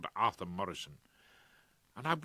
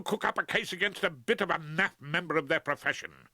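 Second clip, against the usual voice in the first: wrathful; 7 semitones higher than usual. Acted. Liz denounces Rumpole to his face, furious. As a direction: pour out furious scorn. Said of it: "extremely wrathful Miss Liz Probert".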